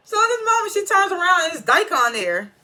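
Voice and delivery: high and loud with excitement; excited. Real, not acted. Telling viewers the twist, high and excited. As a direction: squeal out the twist. Big reveal in the recap.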